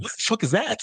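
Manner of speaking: high-pitched mock disbelief; imitated bafflement. Imitating a sister hearing an odd word, high mock bafflement. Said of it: "sister just looking like".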